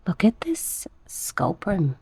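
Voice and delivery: slow whisper of "Look at this Sculprum"; awed whisper. Real, not acted. Showing viewers the Sculprum plant, in an awed whisper. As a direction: breathe it out in awe.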